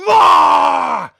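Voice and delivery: loud high yell at the dragon; mock yell. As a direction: bark a sudden scream to scare it. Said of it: "He just yelled at him".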